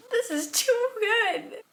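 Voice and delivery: loud, high, drawn-out squeal; overwhelmed delight. Real, not acted. Fan reacting to a kissing scene, squealing in delight. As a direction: wail it slowly, overcome with glee.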